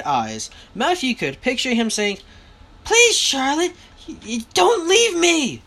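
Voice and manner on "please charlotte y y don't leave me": high, stammering plea; pleading. A narrator's reading. Father imagined begging his wife not to leave, high and pleading. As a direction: beg her, voice lifted high.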